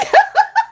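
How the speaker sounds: very high, loud shriek of laughter. Real, not acted. Laughing hard.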